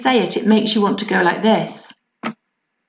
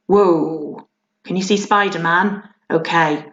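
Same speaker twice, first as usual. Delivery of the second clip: ordinary talk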